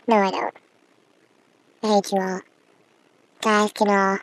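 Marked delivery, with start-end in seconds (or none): none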